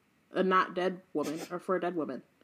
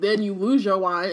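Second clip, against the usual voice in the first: ordinary talk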